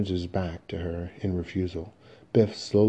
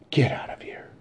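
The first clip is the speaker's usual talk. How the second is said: whispered dismissal; hushed order. Acted. Mother dismissing her self-loathing son, hushed order. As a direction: send him away in a whisper.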